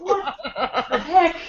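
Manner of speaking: high, laughing shock; startled. Real, not acted. Co-host reacting to a surprise sound, startled. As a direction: recover from a real fright, half laughing. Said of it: "scared the crap out of me".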